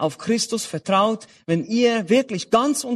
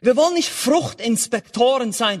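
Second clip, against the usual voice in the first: normal talking voice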